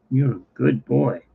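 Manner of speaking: ordinary talk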